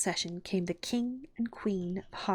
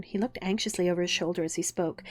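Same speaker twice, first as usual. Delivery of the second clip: plain reading voice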